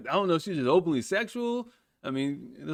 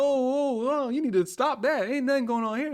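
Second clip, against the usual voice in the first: higher pitched, insistent shut-down; panicked denial; 8 semitones higher than usual. Retold or imitated. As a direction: stop the rumor fast. Retelling his panicked denial.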